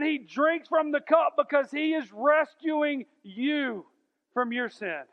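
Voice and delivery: fervent preacher lifting the room; preaching at full force. Acted. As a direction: preach it out with rising fire. Preaching climax.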